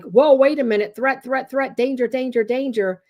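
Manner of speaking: high mock siren of warnings; amygdala alarm. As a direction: fire off warnings as a panicking brain. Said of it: "the amygdala is like".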